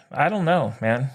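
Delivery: usual speaking voice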